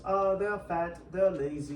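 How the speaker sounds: mocking a foreign meme reply. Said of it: "They say".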